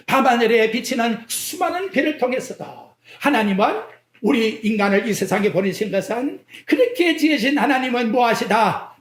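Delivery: normal talking voice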